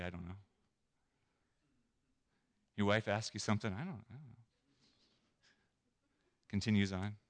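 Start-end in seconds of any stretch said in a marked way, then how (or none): 0.0-0.4 soft, evasive mumble; sheepish mumble. Pretend. Husband evading his wife. 3.7-4.4 soft, evasive mumble; sheepish mumble. Pretend. Husband evading his wife.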